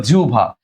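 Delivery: জিহ্বা with a u glide before a single bh; correct pronunciation. Shown as a demonstration. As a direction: say জিহ্বা as "jiu-bha". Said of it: "বলো কি হয়ে যাবে".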